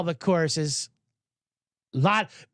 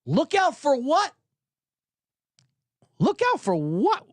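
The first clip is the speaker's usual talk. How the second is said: drawn-out, higher mock disbelief; retold indignant reaction; 8 semitones higher than usual. Driver answers the golfer he nearly hit, mock indignant. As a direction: act out the driver's incredulous comeback. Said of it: "his reaction was".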